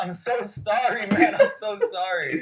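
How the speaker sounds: high-pitched, hammed-up pleading; frantic mock apology. Apologizing to a girl he smacked, mock frantic. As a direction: plead for forgiveness, playing it for laughs.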